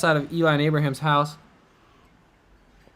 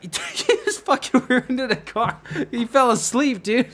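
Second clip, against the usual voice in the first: higher, slow, laughing disbelief; 8 semitones higher than usual. Retold or imitated. Telling others friend crashed, laughing disbelief. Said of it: "I was just like".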